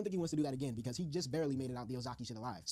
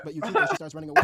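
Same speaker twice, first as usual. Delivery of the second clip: much higher and louder, excited; hyped; 14 semitones higher, 15 dB louder, faster than usual. Real, not acted. Vlogger loudly hyping Yukiko running away. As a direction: hype the moment up loud and high. Excited narration, rising hype.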